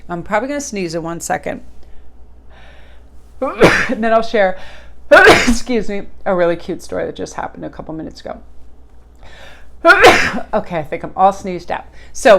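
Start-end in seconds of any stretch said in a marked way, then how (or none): 1.7-3.4 quiet dry cough. Real, not acted. Coughing before sneeze. 5.1-6.1 cough. Real, not acted. Coughing fit. 9.2-10.5 loud hard cough. Real, not acted. Coughing fit.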